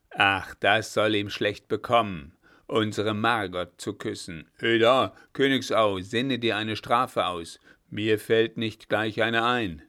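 put-on loud, blustering old general; Blücher calling on the lieutenant to punish the kidnappers, loudly; angry old marshal. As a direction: roar out a threat of punishment.

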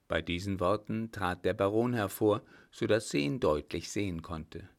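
usual speaking voice

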